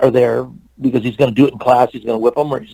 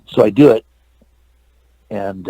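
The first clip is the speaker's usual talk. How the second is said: usual speaking voice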